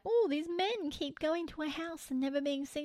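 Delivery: mock cluelessness. Host mimicking townsfolk watching men vanish, mock clueless. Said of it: "They're like".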